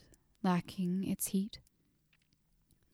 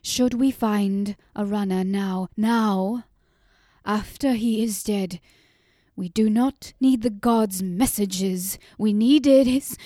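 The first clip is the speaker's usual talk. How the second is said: louder and louder, anger building until the voice cracks; rising angry outburst; 8 dB louder than usual. A narrator's reading. Grieving mother lashing out at the visitors, angry and loud. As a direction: let the anger swell to breaking point. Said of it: "did not veil the anger".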